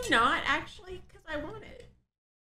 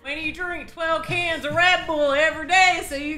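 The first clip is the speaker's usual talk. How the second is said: put-on Southern accent; 3 dB louder than usual. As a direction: read it in a hammed-up Southern accent. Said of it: "went with the Southern accent".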